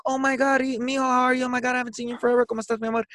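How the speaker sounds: high, bright-voiced mom gushing over her son; mom's excited greeting. Son retells mom greeting him at work, gushing high. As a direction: gush like a mom who missed her boy. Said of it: "she was like".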